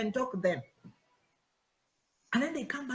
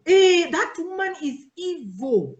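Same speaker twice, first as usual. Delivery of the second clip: higher, louder, slow dramatic gossip; gossip's warning; 6 semitones higher, 6 dB louder, slower than usual. Retold or imitated. Church gossip warning the newcomer, dramatic and loud. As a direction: drag it out like a scandalized gossip. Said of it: "vile rumor spread".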